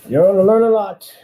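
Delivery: loud, high threat; angry shout. Real, not acted. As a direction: yell the warning at him. Threatening the man he'll sue.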